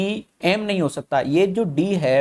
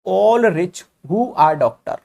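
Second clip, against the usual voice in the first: slow dictation; 2 semitones higher, 3 dB louder, much slower than usual. Shown as a demonstration. Teacher reading the statement slowly.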